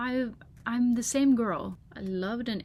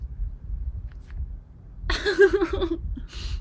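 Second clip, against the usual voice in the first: high breathless wheezing laugh, louder; helpless laughter; 10 semitones higher, 5 dB louder than usual. Real, not acted. Looking at her old drawings, high wheezing laugh.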